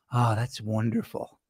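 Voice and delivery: soft, low, savoring murmur; low, hushed wonder, quoting him; delighted quoted reply. Retold or imitated. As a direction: answer quietly, relishing it. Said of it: "his answer was".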